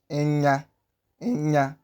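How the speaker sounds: "enya" with a long nasal ny. Shown as a demonstration. Teacher modelling the digraph, lengthened ny in enya. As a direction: hold the nasal in "enya" before releasing the a. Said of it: "pay proper attention to how I'm pronouncing it".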